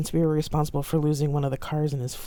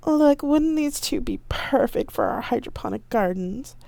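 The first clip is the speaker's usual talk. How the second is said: fussy fiancé; 9 semitones higher than usual. A narrator's reading. Ibo keeps up fussy fiancé act.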